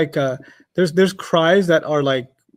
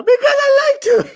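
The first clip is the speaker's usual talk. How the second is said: put-on crying child; 5 dB louder than usual. Daughter answering her mother through mock tears. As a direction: whimper the answer like a hurt toddler. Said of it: "That's what I say to myself".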